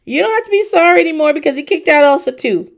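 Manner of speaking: high, loud, fast gleeful line; imitating Audrey delighting in the drama; gleeful mock announcement. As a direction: gloat it out quickly. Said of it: "comes out with Mama Elsa and says".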